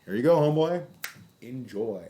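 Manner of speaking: high, loud, breezy offer; cheery pretend compliance; mock cheerful handover. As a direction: hand it over like a pal. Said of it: "I'd have been like".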